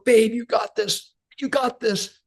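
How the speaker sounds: high, soft encouragement; husband urging his wife toward the table; cheering on. Retold or imitated. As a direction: lift her up, you believe in her. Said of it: "the husbands are going".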